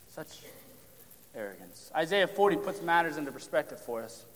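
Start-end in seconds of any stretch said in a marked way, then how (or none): 0.1-1.9 much softer, almost breathed, lower; a quiet echo of disgust; hushed repeat. Acted. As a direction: let it sink in with a hush. Preacher repeats phrase for weight.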